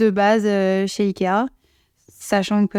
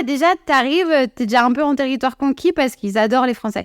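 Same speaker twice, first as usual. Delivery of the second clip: ordinary talk, nothing marked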